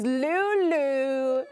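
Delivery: very high, drawn-out pet talk; cooing at dog. Real, not acted. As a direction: greet a beloved dog in a high, slow coo.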